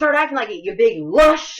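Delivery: imitating a player chewing out her coach; scolding. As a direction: tell the drunk coach off.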